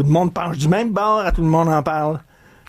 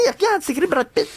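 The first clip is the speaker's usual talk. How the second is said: insistent wife; 9 semitones higher than usual. Retold or imitated. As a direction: plead it's really him. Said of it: "t'as dit".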